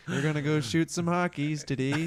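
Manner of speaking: put-on Minnesota accent.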